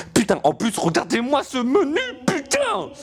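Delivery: rising into a high squeal; hyped exclamation. Acted. Gamer showing off the menu to viewers, squealing hype. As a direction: get carried away, climbing very high on the final swear. Gushes over the game menu.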